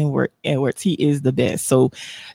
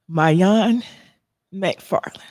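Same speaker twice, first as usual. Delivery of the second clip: usual speaking voice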